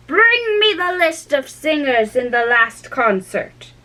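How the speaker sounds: slow, high commanding queen; stern Queen. Acted. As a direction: order it like a queen. Voices the Queen.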